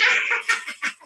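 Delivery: airy unvoiced laugh at her own joke; breathy cackle. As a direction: laugh silently to yourself.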